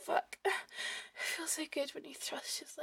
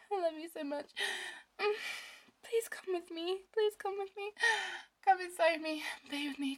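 higher, breathy and urgent; overwhelmed pleading; 6 semitones higher, 3 dB louder than usual. Acted. Lover overwhelmed with a partner, urgent breathless pleading. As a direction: plead as if losing control. Acted love scene, near its peak.

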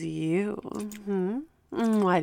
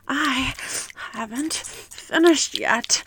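normal talking voice

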